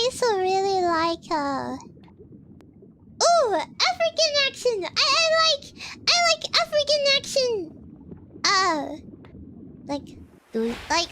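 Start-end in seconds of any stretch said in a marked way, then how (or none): 3.2-7.5 high, quick burst of delight; excited gushing. Real, not acted. Streamer naming a favourite accent, excited gushing. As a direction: gush about a favourite, pitched up.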